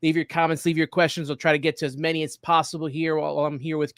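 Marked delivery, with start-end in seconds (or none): none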